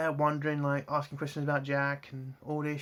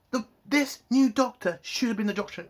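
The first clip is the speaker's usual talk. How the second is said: real anger in a higher pitch; angry insistence; 6 semitones higher than usual. Real, not acted. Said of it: "Sorry, I'm just angry".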